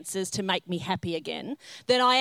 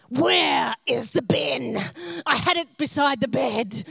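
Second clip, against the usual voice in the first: harsh, slow, drawn-out mock yell; hammed-up furious shout. Wife demanding her bin from husband, hammed-up fury. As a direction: roar it through a rasping whisper-shout.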